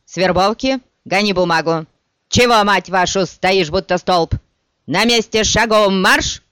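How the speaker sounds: loud, high barking sergeant; shouting drill sergeant. Acted. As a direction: bellow orders at the recruits. Sergeant barks orders at recruits.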